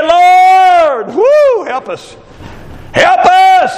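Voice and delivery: high cry of a desperate apostle; pleading apostle. Retold or imitated. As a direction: cry out to the Lord for help. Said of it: "And the apostle said".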